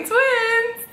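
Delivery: high, slow, drawn-out reveal; announcing the news. Retold or imitated. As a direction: stretch it out high for the big reveal. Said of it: "And I was like".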